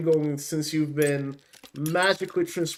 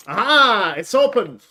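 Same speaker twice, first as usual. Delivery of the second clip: excited; 3 semitones higher, 7 dB louder than usual. Real, not acted. Lockpick succeeds, box opens.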